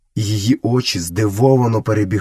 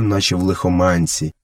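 ordinary delivery

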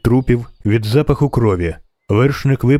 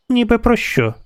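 put-on high-pitched child's question; little girl; 11 semitones higher than usual. A narrator's reading. Girl in the tree questions the witcher, high child's voice. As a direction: answer back in a small girl's voice.

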